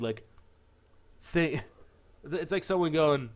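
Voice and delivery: ordinary delivery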